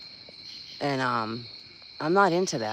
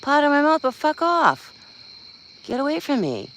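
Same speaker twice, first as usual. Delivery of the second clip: much higher, squeaky dismissive voice; a tiny mock voice telling someone to get lost; squeaky mock retort; 11 semitones higher than usual. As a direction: brush them off in a cartoonish voice. Acted retort, same mock voice.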